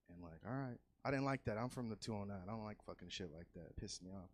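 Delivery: ordinary delivery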